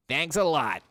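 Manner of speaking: chirpy robot voice imitation. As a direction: copy the robot's cheerful thanks. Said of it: "he says fucking".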